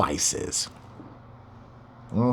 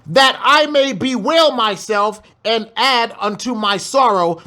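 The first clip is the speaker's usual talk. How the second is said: scripture reading; 5 semitones higher than usual. Acted. Preacher reading the grieving woman's words, raised.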